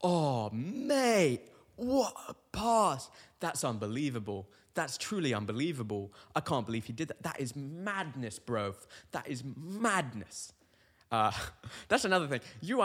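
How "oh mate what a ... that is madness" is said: excited Australian bloke, mock amazed; overhyped Australian rugby fan reaction; Aussie rugby fan. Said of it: "they'll be like".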